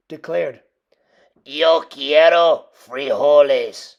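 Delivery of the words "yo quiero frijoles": slow, low, grave declaration; somber voice. A narrator's reading. Don Diego solemnly declares to the gathered dogs. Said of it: "in his most somber voice".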